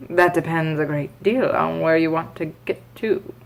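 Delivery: a hint of the grinning cat; lightly voiced cat, calm; Cheshire cat voice. A narrator's reading. Cat answering Alice, lightly voiced. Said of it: "said the cat".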